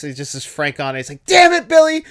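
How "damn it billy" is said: big exasperated bellow, much higher and louder; angry dad yell. Retold or imitated. Retelling the movie, the dad yells at his son, furious shout. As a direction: roar it like a fed-up father.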